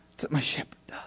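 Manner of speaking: hushed, breathy whisper of awe. Acted. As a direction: drop to a whisper to close the point. Whispered climax of sermon.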